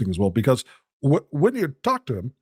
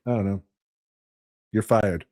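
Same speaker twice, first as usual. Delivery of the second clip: low, deadpan mock Trump; Trump catchphrase; 5 semitones lower than usual. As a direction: drop the voice and fire them flatly. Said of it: "he's like".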